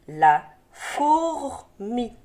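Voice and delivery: "La fourmi" very slow, la - four - mi, R held; sounded out. Shown as a demonstration. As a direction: stretch "fourmi" with a long R before "mi". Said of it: "Attention au R".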